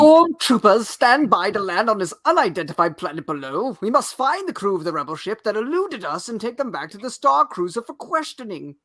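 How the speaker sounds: loud, high commanding officer voice; Imperial officer. Acted. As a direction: bark orders like a hammy villain. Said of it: "the dialogue is pretty bad with the Imperials".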